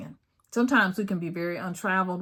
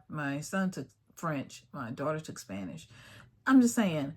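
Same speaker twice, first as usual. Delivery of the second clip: usual speaking voice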